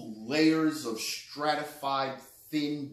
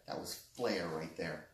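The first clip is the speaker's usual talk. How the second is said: ordinary talk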